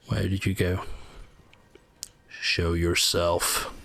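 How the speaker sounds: slow, drawn-out game quote; quoting Skyrim. Retold or imitated. Player quoting a Skyrim line while hunting a foe, slow imitation. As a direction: imitate a game enemy, words stretched slowly. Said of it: "another Skyrim, uh, quote".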